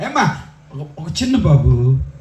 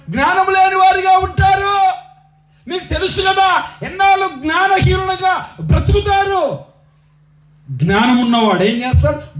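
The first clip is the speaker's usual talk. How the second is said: ringing rhetorical questions; full-force preaching; 12 semitones higher than usual. Real, not acted. Preacher asks what a wise person would do, full-force preaching. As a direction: drive each question at the congregation.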